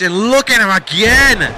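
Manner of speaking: a sudden lift in volume; excited match call. Real, not acted. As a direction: burst out at the repeat move. Commentator reacts to repeated move.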